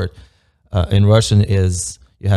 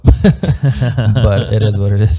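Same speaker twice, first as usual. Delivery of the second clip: laughing; 5 dB louder, slower than usual. Real, not acted. Laughing off a bad outcome.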